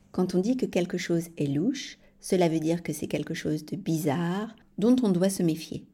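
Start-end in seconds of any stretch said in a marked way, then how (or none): none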